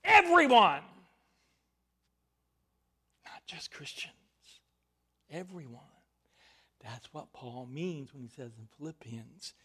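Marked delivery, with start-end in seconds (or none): 0.0-0.8 a full-voiced, high call to everyone; loud proclamation. Real, not acted. Preacher's loud opening proclamation. 3.2-4.2 hushed aside. Real, not acted. Quieter aside after proclamation. 5.3-5.8 soft, intimate repeat of the word; quiet echo. Real, not acted.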